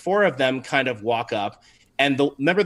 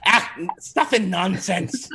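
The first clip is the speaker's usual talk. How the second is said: put-on old lady; 6 semitones higher than usual. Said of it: "she says".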